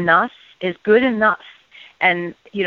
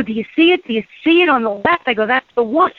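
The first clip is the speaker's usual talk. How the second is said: high, eager retold calling out; reliving the thrill of pointing it out; wife on the phone urging husband to look, excited; 5 semitones higher, faster than usual.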